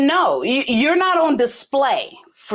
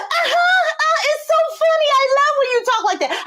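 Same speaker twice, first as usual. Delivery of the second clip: put-on much higher, squealing girlish voice; daughter's giggly reply. Daughter laughing at her mother's threat, high and squealy. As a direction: play the amused teen teasing back.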